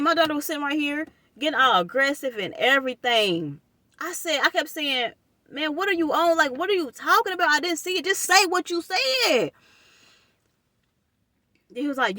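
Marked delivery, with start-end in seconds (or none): 5.5-9.5 high, exasperated questioning; exasperated demand. Retold or imitated. Demanding he explain his text, pitched high and exasperated. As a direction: press him, fed up and pitched high. Retelling her frustrated call reply.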